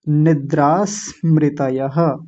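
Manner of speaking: slow निद्रा स्मृतयः with s linked to nidra; joined pronunciation. Shown as a demonstration. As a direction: draw out निद्रा स्मृतयः to a final ha. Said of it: "फिर से देखिए".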